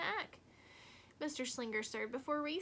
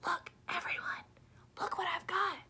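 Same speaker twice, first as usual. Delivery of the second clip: urgent hissing whisper, higher; fierce whisper; 6 semitones higher than usual. Acted. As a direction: whisper to the class, bursting to show. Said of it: "Lily whispered fiercely".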